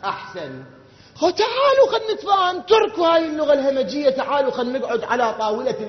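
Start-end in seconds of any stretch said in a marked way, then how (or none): 1.2-5.9 high, loud mock plea to opponents; mock pleading shout. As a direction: call out to them in a high, loud mocking voice. (نداء ساخر بصوت عالٍ ومرتفع)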